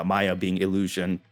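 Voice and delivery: ordinary delivery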